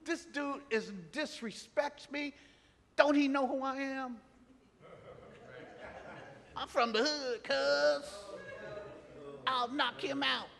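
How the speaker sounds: street tough. Retold or imitated. Preacher mocking his old street self, cocky and high.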